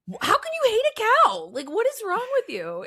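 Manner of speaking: very high, incredulous squeal of protest; mock outrage. Acting out outrage at cow-hater.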